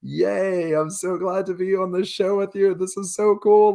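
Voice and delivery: put-on high, gushing childlike voice; childlike excitement. Greeting Drew Carey, high gushing childlike glee. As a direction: gush like a starstruck kid. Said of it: "I'm just like".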